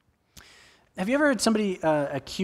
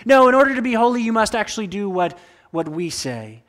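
loud, high imitated Pharisees laying down the law; mock Pharisee demand; 4 semitones higher, 8 dB louder than usual. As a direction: bark out the rule like a smug religious elite. Pharisees voiced demanding obedience.

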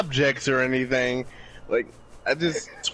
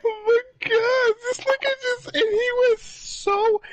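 very high, tearful squeal; real laughter close to sobbing; crying with laughter. Real, not acted. Friend helpless with laughter.

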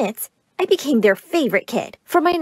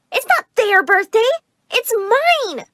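put-on very high, hurt little-kid voice; upset child protest; 12 semitones higher, slower than usual. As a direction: object like a child robbed of a birthday.

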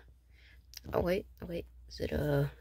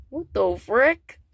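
louder, higher, drawn-out shock; slow shocked outburst; 7 semitones higher, 7 dB louder, much slower than usual. Acted. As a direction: stretch the disbelief out.

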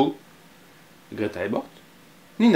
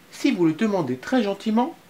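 higher, lightly voiced little boy; child voice; 8 semitones higher than usual. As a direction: play Anton offering treats sweetly. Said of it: "dit Anton".

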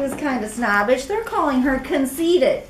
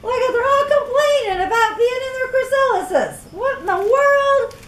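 much higher, delighted disbelief; amused surprise; 13 semitones higher, 4 dB louder than usual. Real, not acted. Reader marvels at the complaining caterpillars, high and bright. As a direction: exclaim in delight. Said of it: "reader amused by the story".

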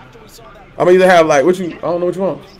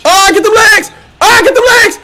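excited yell; 11 semitones higher than usual. Real, not acted. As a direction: cheer the runner on at full voice. Yelling at his runner on screen.

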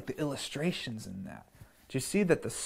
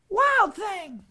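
much higher scolding call; mother scolding; 5 dB louder than usual. Acted. Mother scolding Max in his wolf suit, higher sharp call. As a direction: call him out like an exasperated mom. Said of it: "his mother called him".

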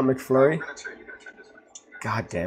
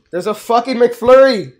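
much higher, louder incredulous yell; disbelieving yell; 12 semitones higher, 12 dB louder than usual. Real, not acted. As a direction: shout it out in disbelief. Yelled in disbelief.

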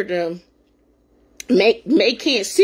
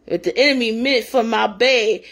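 normal talking voice

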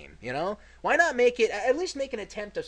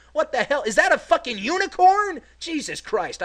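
much higher and louder, stunned; shocked disbelief; 11 semitones higher, 8 dB louder than usual. Real, not acted. Gamer spotting a unicorn, shocked loud outburst. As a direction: yelp it out in disbelief.

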